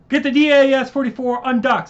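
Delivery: ordering his brother to undock, retaken; urgent shout. Acted. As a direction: bark the order over the battle. Retake after outage.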